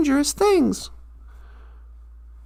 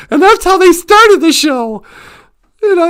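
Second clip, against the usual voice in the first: normal talking voice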